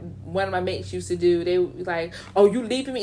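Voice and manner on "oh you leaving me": manipulative. Retold or imitated. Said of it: "It's almost like a manipulation".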